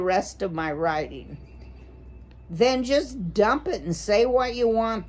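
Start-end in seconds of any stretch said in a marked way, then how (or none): 2.5-5.1 higher, impatient booming command; booming owl. A narrator's reading. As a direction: boom it out impatiently. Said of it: "the owl boomed".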